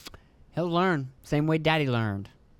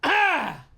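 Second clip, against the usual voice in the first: a sharp high cry, then stop; sudden high yelp; 12 semitones higher, 4 dB louder than usual. Real, not acted. Sudden outburst during coughing.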